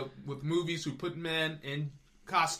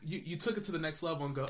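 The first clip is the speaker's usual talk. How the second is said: ordinary delivery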